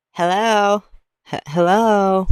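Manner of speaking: loud, high mock lady voice; pushy, high-pitched "hello?"; weird lady. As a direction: call out high like an odd woman. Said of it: "like, some weird freaking lady".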